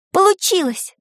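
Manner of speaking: high-pitched burst of triumph; delighted exclamation. A narrator's reading. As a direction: blurt it out, unable to hold back. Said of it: "не удержавшись, воскликнула Таня".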